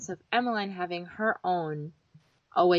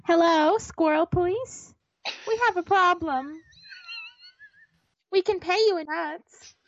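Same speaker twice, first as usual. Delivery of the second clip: high, put-on voice calling the squirrel police; a goofy, pleading call for help; silly phone call; 9 semitones higher, 3 dB louder than usual.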